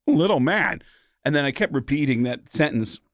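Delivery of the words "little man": higher, slow, oddly paused phrasing; Christopher Walken impression. Shown as a demonstration. Warming up into a Christopher Walken voice, slow and higher. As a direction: find Walken's voice through the phrase. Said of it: "that's all I could say was".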